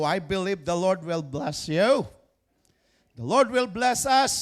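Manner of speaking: loud, high, slow proclamation of blessing; preaching at full force. Acted. Pastor to congregation, a loud high proclamation. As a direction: cry it out over the congregation.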